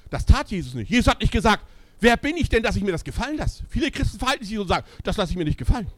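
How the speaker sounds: high, loud rebuke from the pulpit; forceful preaching. Acted. Preacher rebuking Christians who won't take it, loud preaching. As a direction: call them out at full force.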